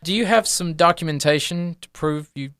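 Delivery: high, emphatic promise, then officious query; brisk, self-important little academic; fussy gnome professor. Acted. Gnome professor answers his patrons.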